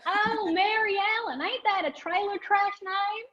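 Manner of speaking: high-pitched, hammed-up Southern accent; bad Southern accent. People reacting to her name, bad Southern accent. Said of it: "a trigger for a bad Southern accent".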